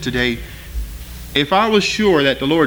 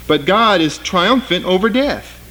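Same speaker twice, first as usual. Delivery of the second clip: usual speaking voice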